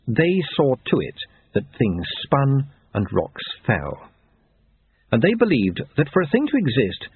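ordinary delivery, nothing marked

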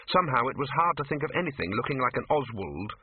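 ordinary delivery

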